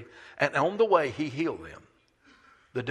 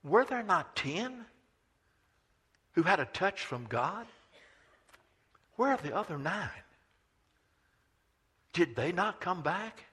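Jesus pleading for the missing nine; pleading question; 4 semitones higher, 3 dB quieter than usual. Acted. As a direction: ask with a wounded heart. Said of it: "Jesus said".

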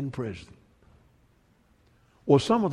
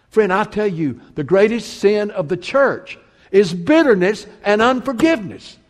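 loud, higher, driving sermon voice; preaching at full force; 5 semitones higher, 6 dB louder than usual. Acted. Preacher warning the church about bitterness, loud and driving. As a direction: hammer the warning home to the church.

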